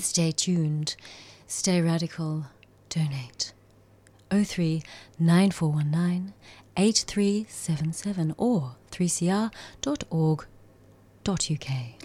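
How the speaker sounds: hushed upper-class English announcement; posh English accent. Shown as a demonstration. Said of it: "Do you want a posh one?".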